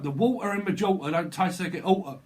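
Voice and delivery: exaggerated London accent, punched out. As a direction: drop the t's like a Cockney in "water" and "oughta". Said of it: "You're in London".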